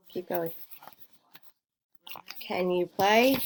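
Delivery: usual speaking voice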